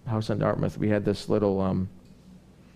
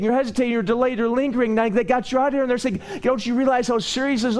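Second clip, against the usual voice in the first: full-force preaching; 8 semitones higher than usual. Acted. Preacher urging the congregation not to linger, fervent. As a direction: drive the sermon home to the congregation.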